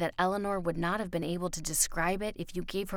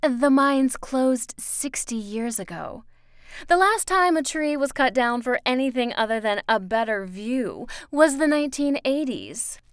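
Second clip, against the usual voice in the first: high, loud, emphatic woman's voice; insistent raised-pitch lecturing; Miss Foster, correcting; 6 semitones higher, 8 dB louder than usual. Acted. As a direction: hammer the facts home to a child.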